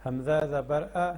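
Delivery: slow sing-song spelling chant, drawing out each joined word; measured classroom recitation of the Noorani Qaida. Shown as a demonstration. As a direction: chant each letter and its vowel for pupils to repeat. Teacher chants spelling drill.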